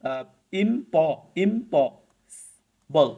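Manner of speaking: ইমপসিবল broken up very slowly, part by part; slow teaching split of ইমপসিবল; syllable by syllable. Shown as a demonstration. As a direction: spell out ইমপসিবল in sound chunks. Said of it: "চারটা ভাগে ভাগ করা যায়".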